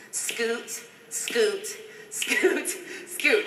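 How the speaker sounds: bouncy repeated "scoot"; playful cue. Shown as a demonstration. Choreographer cueing the scoot move. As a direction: chant "scoot" in rhythm, playfully. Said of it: "a little scoot".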